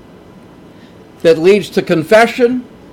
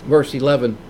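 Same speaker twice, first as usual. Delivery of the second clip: ordinary talk, nothing marked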